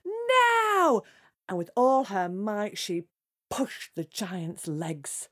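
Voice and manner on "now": high, drawn-out princess shout; shouted signal. Acted. Princess shouts back before pushing the giant, long high shout. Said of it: "And the princess shouted".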